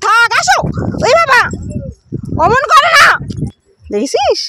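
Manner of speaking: loud, high parental soothing; high-pitched coaxing. Real, not acted. Parent soothing child after sibling quarrel, high and loud coaxing.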